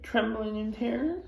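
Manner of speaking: plain reading voice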